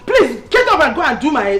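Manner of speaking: loud, impatient command; angry order. Acted. Mother-in-law ordering wife to cook, angry order. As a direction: chase someone off to do a chore.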